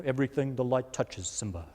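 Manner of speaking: very deep, quiet lion king father voice; Lion King impersonation. Retold or imitated. Pastor doing a Lion King impression for the congregation, deep rumbling voice. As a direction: rumble it low like Mufasa. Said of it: "That was my Lion King impersonation".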